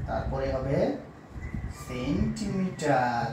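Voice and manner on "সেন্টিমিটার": 2 drawn out very slowly for pupils to repeat; 3 say kilometre syllable by syllable, very slowly; 1 slow dictation. Shown as a demonstration. 4 teacher reading kilometre aloud for pupils to copy, very slow. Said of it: "উচ্চারণ করে শোনাবো".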